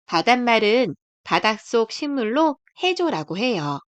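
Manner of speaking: ordinary delivery, nothing marked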